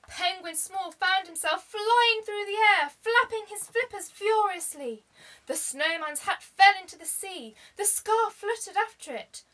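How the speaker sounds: plain reading voice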